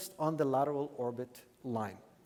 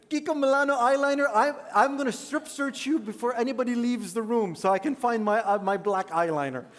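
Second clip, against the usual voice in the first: loud, high joking shout; yelling across the room; 6 semitones higher, 8 dB louder than usual. Real, not acted. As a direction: playfully shout a threat to the room. Jokingly threatens strip search.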